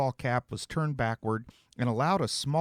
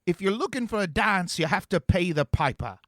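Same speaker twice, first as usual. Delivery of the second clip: a lightly put-on British accent, louder; teen taunting in a mild fake British accent; 7 semitones higher, 5 dB louder than usual. A narrator's reading. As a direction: keep up the cheesy British act. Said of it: "knock off that accent".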